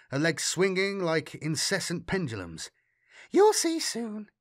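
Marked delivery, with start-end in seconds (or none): 3.3-4.3 put-on high, slow, airy girl voice; airily. A narrator's reading. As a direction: tease it lightly like a secret. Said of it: "she said airily".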